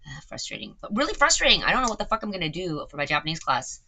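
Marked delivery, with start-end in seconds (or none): 0.8-2.5 frustrated outburst. Real, not acted. Streamer venting about broken AI.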